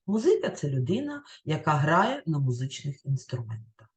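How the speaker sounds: ordinary delivery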